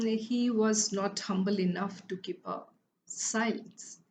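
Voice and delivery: ordinary delivery, nothing marked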